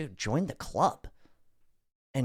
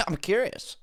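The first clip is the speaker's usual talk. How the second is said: higher, mock-prissy echo of "I'm curious."; mocking imitation; 8 semitones higher than usual. Host mocking the finance reporter's "I'm curious.". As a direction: parrot the host back with a teasing lilt. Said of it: "He goes".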